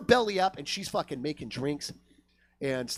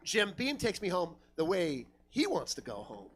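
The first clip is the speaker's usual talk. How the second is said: normal talking voice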